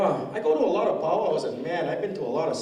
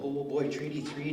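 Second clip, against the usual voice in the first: normal talking voice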